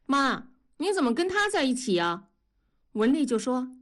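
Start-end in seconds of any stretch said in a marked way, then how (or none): 0.1-2.3 put-on higher child's voice asking mom; a kid's puzzled question; young son questioning his mother. A narrator's reading. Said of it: "大宝问".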